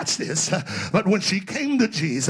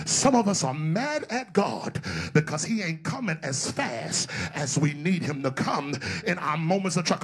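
ordinary delivery

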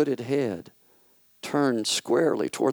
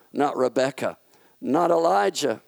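ordinary delivery

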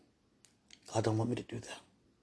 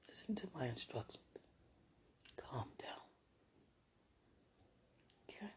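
soft whispered command to calm; hushed instruction; 15 dB quieter than usual. Real, not acted. Telling herself to calm down, in a soft whisper. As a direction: whisper it gently but firmly.

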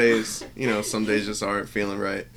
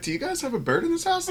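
mock visitor.

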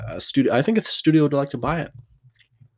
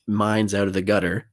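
ordinary delivery

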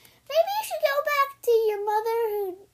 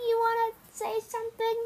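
ordinary talk, nothing marked

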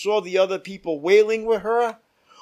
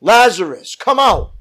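loud commanding call, voice raised strongly; Jesus shouting Lazarus out of the grave, loud; read as a shout; 2 semitones higher, 7 dB louder than usual. A narrator's reading. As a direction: call the dead man out of the tomb. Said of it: "Then Jesus shouted".

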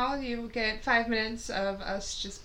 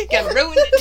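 laughing fit; 7 dB louder than usual. Real, not acted. Breaks up laughing.

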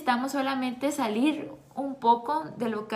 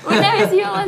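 laughing; 9 semitones higher, 12 dB louder than usual. Real, not acted. Laughing at cohost's interruption.

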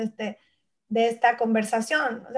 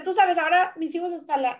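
usual speaking voice

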